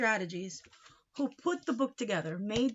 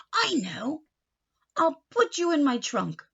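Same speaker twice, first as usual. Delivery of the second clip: higher, louder bright realisation; sudden idea; 6 semitones higher, 5 dB louder than usual. Acted. Uncle to his old hat, pleased idea. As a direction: land on the plan.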